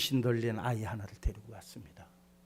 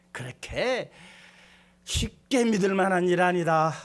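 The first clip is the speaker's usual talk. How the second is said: fervent sermon climax; preaching at full force; 10 semitones higher, 12 dB louder than usual. Acted. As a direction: pound out each line to the congregation.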